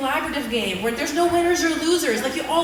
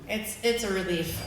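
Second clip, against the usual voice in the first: ordinary talk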